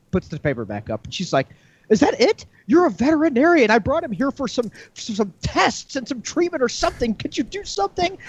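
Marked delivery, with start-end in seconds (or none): 1.9-8.2 high indignant lady voice; lady protesting to the vet, high indignant. Retold or imitated. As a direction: squeak out the outrage.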